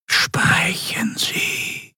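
slow, hushed, distant whisper; cold and menacing, barely voiced; sinister whispering governor. Governor whispering coldly to the admiral for his report.

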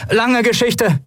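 high call over the wind; calling back. A narrator's reading. Endriel answers Kai in the storm, calling back high. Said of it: "gab Endriel zurück".

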